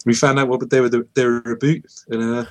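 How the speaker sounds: mock Canadian accent; hammy Canadian voice; fake Canadian accent. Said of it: "That's good Canadian".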